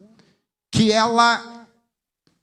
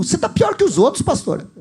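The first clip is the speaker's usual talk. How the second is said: mock the offended widow snapping back; retold angry outburst; 9 semitones higher than usual. Preacher retelling how the widow shouted at him, mock indignant. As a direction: pitch jumps much higher, quick and indignant. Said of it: "Aí ela gritou".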